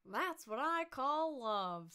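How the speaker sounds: put-on loud, slow, high drunkard; boozy, drawn-out proclamation; tipsy. A narrator's reading. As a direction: slur it like a happy drunk. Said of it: "with a voice more tipsy than ever".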